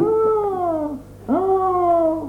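small high whimpering oh; a scared little girl crying out; high, soft frightened cry; frightened child's cry. A narrator's reading.

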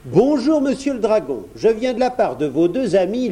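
put-on high, boyish character voice; courteous little prince. A narrator's reading. Prince delivers a message to the dragon, courteously.

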